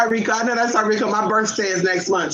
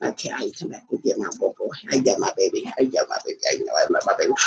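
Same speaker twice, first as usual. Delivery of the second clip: soft unvoiced whisper, much lower; whisper to the dog; 11 semitones lower, 6 dB quieter than usual. Real, not acted. To her dog, a soft tender whisper.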